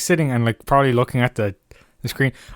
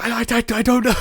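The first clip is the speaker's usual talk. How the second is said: high stammering mock voice; much higher mock stammer; imitating flustered friend; 10 semitones higher, much faster than usual. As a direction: tease him with a flustered stutter. Mocking co-host's flustered reply.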